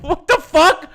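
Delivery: high, loud shout through laughter; incredulous laughing yell. Retold or imitated. Boss retelling an absurd retort, laughing shout. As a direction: yell it in disbelief while cracking up.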